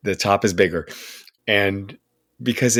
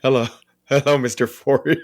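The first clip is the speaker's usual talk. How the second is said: starstruck laughing greeting; 5 semitones higher than usual. Retold or imitated. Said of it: "And I'm like".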